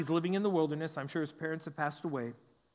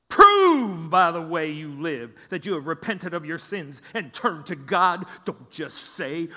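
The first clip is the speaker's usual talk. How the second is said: put-on booming Old Testament prophet; 9 dB louder, slower than usual. Shown as a demonstration. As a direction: thunder it like a wild prophet. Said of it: "put on a, a prophet voice here".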